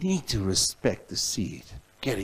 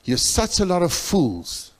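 retold rebuke; 5 semitones higher than usual. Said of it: "I'm saying".

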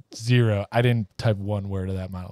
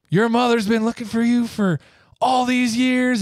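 mock mad; 12 semitones higher than usual. Country girl scolds the runaway son, high and indignant. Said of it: "And she gets all mad".

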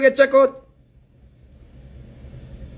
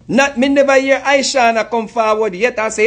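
ordinary talk

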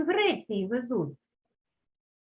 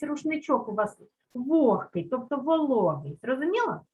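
ordinary talk, nothing marked

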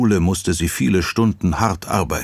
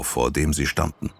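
ordinary delivery

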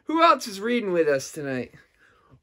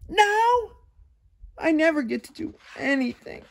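pleading pigeon; 7 semitones higher than usual. Acted. Host voices the Pigeon reading.

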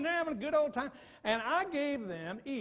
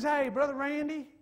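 Southern impression; 6 semitones higher, 5 dB louder than usual. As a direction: mimic a Southern man greeting. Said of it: "I'm doing my best Southern impression".

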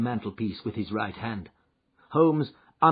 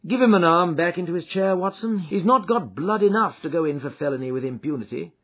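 Holmes, brisk and commanding, a little louder; 9 semitones higher, 9 dB louder, faster than usual. Acted. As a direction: snap out orders to Watson. Holmes orders Watson to help.

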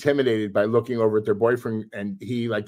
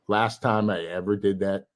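ordinary delivery

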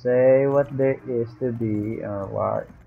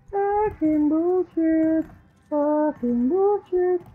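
very high, slow, strange put-on voice; high mock voice; slower than usual. Ranting at the internet in a silly squeaky voice. Swearing, then says cursing again.

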